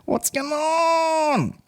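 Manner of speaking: very high, long drawn-out falsetto; silly high voice. Pretend. Comedian puzzled at his ticket sales, silly drawn-out falsetto. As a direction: stretch the last word out in a squeaky wail.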